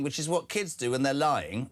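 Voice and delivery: ordinary talk, nothing marked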